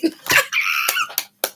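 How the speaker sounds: high breathless squeal-laugh; shrieking laugh. Real, not acted. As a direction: let out a piercing excited shriek. Reader shrieking with excitement.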